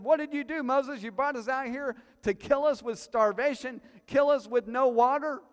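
high, loud accusing whine at Moses; complaining crowd. Acted. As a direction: throw the blame at Moses.

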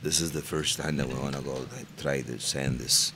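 put-on low, unhurried cartel boss in accented English. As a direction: speak low like a drug lord talking business. Guest plays narco boss in English.

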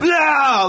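loud high cartoon retch; imitated cartoon puking shout; Family Guy vomiting. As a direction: spew it out long and exaggerated. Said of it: "Like Family Guy".

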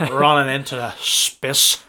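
slow, monotone Northern Irish commentator saying "running into the spiss"; mock Northern Irish commentary, slowed down. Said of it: "Every time I do the Nordy accent".

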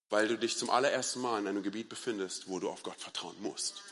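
soft, low, measured delivery; hushed earnest preaching. Real, not acted. Preacher reframing doubt for the congregation, hushed earnest preaching. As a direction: let the key thought land quietly.

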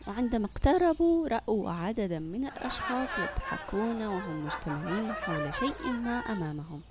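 plain reading voice

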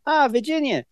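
high, easy mock Maine local voice; breezy imitation of a Maine accent; casual Maine drawl. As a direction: toss it off like a cheerful Maine ski guy. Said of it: "Said it so casually".

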